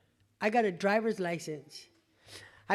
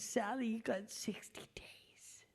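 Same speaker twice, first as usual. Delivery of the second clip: imitating a weepy counselor in a whisper; mock tearful whisper; 13 dB quieter than usual. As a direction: breathe it out like he's choked up. Dude started crying, quoted.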